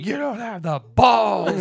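loud, slow, high yell; screaming. Retold or imitated. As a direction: scream it with fury. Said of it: "J D screaming".

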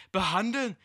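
hoher, lauter, entsetzter Aufschrei; plötzlicher Schrei. Acted. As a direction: schrei das Wort ungläubig heraus. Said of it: "schrie der Posch schlagartig". (hoher, lauter Schrei des Posch)

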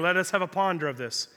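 mock sage, pitched low; pondering beard-stroker. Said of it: "stroking your beard going".

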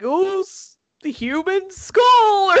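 high, drawn-out mock British exclamation; bad British accent. Boys passing a skull around, hammy British accent. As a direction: attempt British schoolboys, stretched and high. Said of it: "Don't ever do a British accent again".

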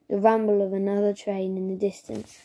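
usual speaking voice

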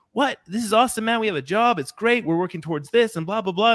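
hammed-up workplace cheerleader gushing about Monday; mock overexcited. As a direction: play the relentlessly upbeat colleague, high and bright-eyed. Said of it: "Rather than being like".

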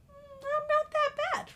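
very high, self-admiring; vain mirror voice. Retold or imitated. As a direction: coo at the mirror, pitched high. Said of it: "I'm like".

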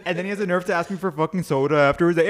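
normal talking voice

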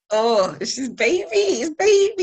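very high, loud, gushing over the newborn; doting squeal. Retold or imitated. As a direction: squeal it like a besotted new mum. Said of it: "And you're just like".

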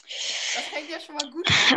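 loud, sarcastic exclamation. Real, not acted. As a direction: shout it out ironically. Sarcastic loud remark at game start.

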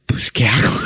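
baffled whisper to himself. Retold or imitated. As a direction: hush it, lost for what to do. Aturdido ante la pelea. (susurro desconcertado)

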